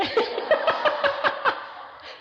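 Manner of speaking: breathy high-pitched laugh. Real, not acted. Laughing at his own joke to the congregation, high laugh. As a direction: laugh out at the punchline.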